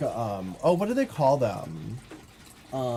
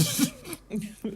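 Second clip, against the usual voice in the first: high squeal laugh; 6 dB quieter than usual. Real, not acted. Laughs at fetish porn remark.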